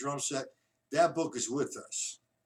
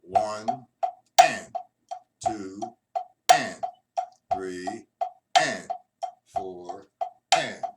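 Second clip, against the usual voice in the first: slow, spaced count with accented ands; 4 semitones lower, 4 dB louder, much slower than usual. Shown as a demonstration. Drum teacher demonstrating the accents slowly, spaced-out count. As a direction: lay each count out slowly and evenly. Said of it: "I'll do that slow".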